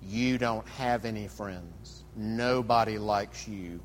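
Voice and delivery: low, slow, soft taunting creature; Gollum. Acted. Sinister creature taunting his other self, low and slow. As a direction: taunt cruelly, dragging it out low and quiet. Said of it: "we're gonna start off with Gollum".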